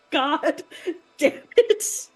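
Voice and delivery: high, loud, slow laugh-cry curse; laughing through tears. Retold or imitated. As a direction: break between laughing and crying on it. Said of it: "and I was like".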